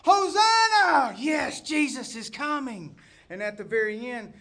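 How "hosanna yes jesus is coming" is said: high, loud, drawn-out cheer easing off; crowd's jubilant shout. Acted. Believers greeting Jesus at his coming, jubilant shout. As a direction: shout it like a welcoming throng.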